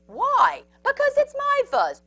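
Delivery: high, loud squabbling character; high-pitched playground quarrel. A narrator's reading. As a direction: argue back in a high, indignant voice. Prairie dogs quarrel over fuzz.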